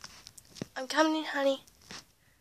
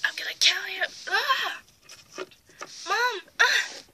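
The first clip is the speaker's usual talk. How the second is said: screaming; 8 semitones higher than usual. Acted. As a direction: scream in terror. Fight, screaming.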